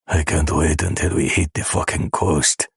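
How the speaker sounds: ordinary delivery, nothing marked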